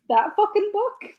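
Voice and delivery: high-pitched mock disbelief, rising at the end; incredulous. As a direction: react as if the question is absurd. Acting Oswald's reaction to the book.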